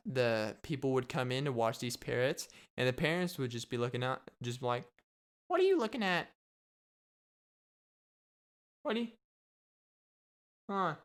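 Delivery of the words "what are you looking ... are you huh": high-pitched squawking parrot voice; squawky parrot. Acted. Cursing parrot to park visitors, high squawky voice.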